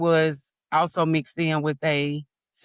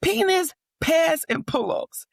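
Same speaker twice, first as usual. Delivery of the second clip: high, slow mock scream; retold scream; 13 semitones higher, 3 dB louder, much slower than usual. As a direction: shout it out in frustration. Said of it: "I remember screaming these dang".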